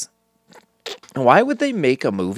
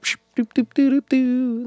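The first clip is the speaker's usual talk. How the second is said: cartoon fight noises; 14 semitones higher than usual. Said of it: "they would be like".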